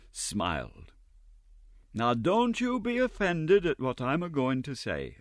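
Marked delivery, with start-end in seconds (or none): 1.9-5.2 higher, sweet coaxing Mrs Mann; captivating sweetness. A narrator's reading. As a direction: charm him with a honeyed voice. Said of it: "with captivating sweetness".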